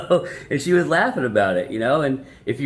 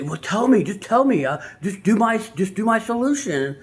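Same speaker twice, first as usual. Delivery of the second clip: higher, pleading insecure man; mock needy plea; 8 semitones higher than usual. An insecure man begs his partner in a higher, needy voice. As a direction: beg her to take your fix, mockingly.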